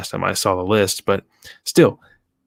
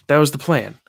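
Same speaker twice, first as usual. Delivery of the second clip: ordinary talk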